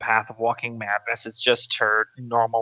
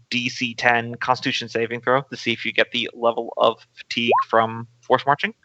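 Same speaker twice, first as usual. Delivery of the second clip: ordinary talk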